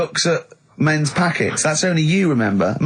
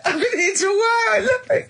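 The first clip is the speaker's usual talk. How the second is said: very high, laughing cry of delight; helpless laughing. Real, not acted. Co-host loving his friend's awkward story, helpless laughing.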